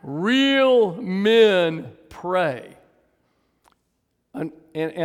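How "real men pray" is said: REAL and MEN hugely stretched and loud, PRAY set apart; extremely slow, booming emphasis on REAL; exaggerated emphasis. Real, not acted. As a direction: drag out every word as far as it goes. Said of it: "Third time for emphasis".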